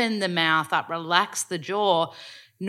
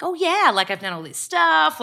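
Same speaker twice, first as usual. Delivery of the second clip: high, loud, mock self-cheerleading; imitated breezy brag, pitched well up; mock American boasting; 10 semitones higher, 4 dB louder than usual. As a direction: talk yourself up like a hammed-up American. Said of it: "Whereas an American".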